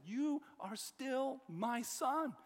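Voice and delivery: high, slow, emphatic declaration; ringing, measured affirmation in God's words; God speaking. Acted. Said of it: "God says".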